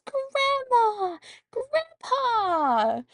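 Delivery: high, loud joyful calling of names; excited shout. Retold or imitated. Girl opening the door to grandparents, squealing with joy. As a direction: squeal their names with delight. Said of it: "She goes".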